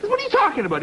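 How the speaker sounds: high-pitched mock incredulity; baffled outrage. Husband questioning the Frenchman's claim, mock incredulous. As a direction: pitch it way up, comically flabbergasted.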